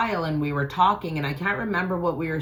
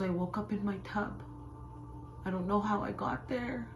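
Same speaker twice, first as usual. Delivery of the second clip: soft, higher, upset voice; tearful retelling; 5 semitones higher, 10 dB quieter than usual. Retold or imitated. Sister admitting she woke in the tub, mildly tearful. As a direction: confess it quietly, lightly imitating tears. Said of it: "she just starts crying".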